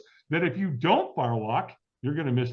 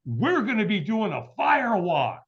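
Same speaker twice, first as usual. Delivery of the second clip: friend on the phone suddenly remembering, much higher; imitating excited friend; 7 semitones higher than usual. Friend remembering the fire walk, mock excited. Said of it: "he goes".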